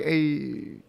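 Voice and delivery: laid-back cool-guy hey. Retold or imitated. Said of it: "It's more like".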